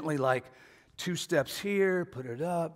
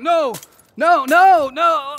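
much higher and louder, a horrified cry of no; horrified shout; 12 semitones higher, 15 dB louder, much slower than usual. Retold or imitated. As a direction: yell it as it tips over. Family watching china fall.

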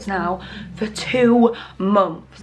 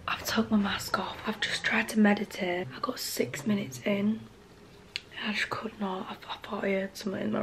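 whisper; 11 dB quieter than usual. Real, not acted. Whispering late at night.

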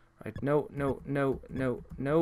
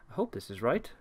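normal talking voice

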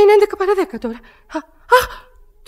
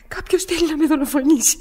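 ordinary delivery

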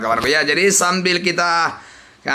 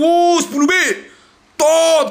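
ordinary talk, nothing marked

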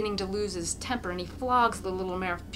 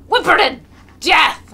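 higher, much louder savage cry; furious yell; 9 semitones higher, 17 dB louder than usual. A narrator's reading. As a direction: scream it in a drunken rage. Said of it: "Mokolka yells".

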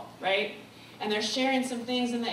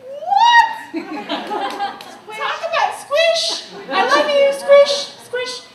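much higher and louder, laughing and gushing; excited squeal; 13 semitones higher, 11 dB louder, slower than usual. Real, not acted. Speaker to audience, squealing with delight about the two women.

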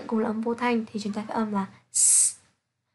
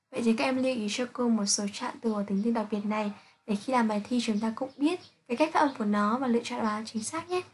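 usual speaking voice

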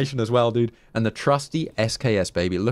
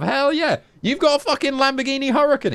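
much higher, hyped up; high excited cheer. Real, not acted. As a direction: gush over the Lamborghini. Thrilled at the car.

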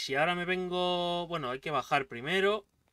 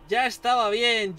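loud, slow ya estaba bien; loud relief; 4 semitones higher, 6 dB louder, slower than usual. Real, not acted. Player loudly relieved after beating the boss. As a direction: draw out the relief.

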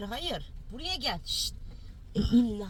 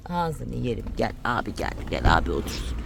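deep voice to dog; 11 semitones lower than usual. Pretend. Talking to the dog.

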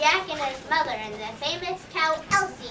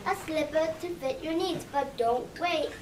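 upbeat commercial voice selling slippers; ad read; 3 dB louder than usual. Acted. Shoe store ad selling slippers to listeners, upbeat pitch. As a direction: pitch it like a radio spot.

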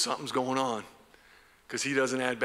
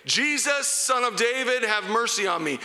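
crying out; 10 semitones higher, 4 dB louder than usual. Acted. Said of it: "he began to cry out".

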